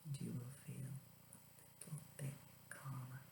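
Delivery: whispered relaxation guide; soft spa whisper. Acted. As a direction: breathe it out softly. Guided breathing exercise.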